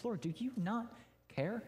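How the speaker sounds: Martha's complaint. Retold or imitated. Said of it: "and she says".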